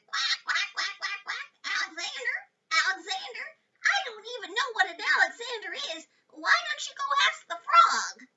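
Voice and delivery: high, quick quacky duck voice ending in a shout. A narrator's reading. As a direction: quack and chatter like a silly duck. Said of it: "the duck said".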